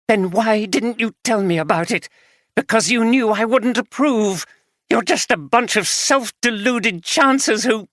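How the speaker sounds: the betrayed wife letting rip; wife accusing husband of gambling, furious; angry wife. Acted.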